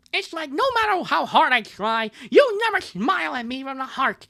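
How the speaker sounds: high, loud lovelorn cartoon voice; hammed-up, high-pitched cartoon heartbreak; Bubsy. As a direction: wail the grievance in a silly high voice. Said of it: "I'm gonna change her voice".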